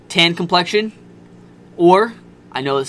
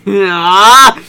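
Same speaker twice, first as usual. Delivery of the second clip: loud laughing cry; laughing yelp; 5 semitones higher, 13 dB louder than usual. Real, not acted. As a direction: burst out laughing. Laughing outburst.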